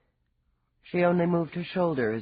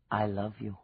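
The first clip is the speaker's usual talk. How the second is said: soft, low hint of an Irish lilt; tender, quietly spoken Irish touch; 4 semitones lower, 5 dB quieter than usual. A narrator's reading. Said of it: "that lovely voice that hinted of Irish mists".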